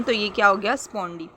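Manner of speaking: ordinary talk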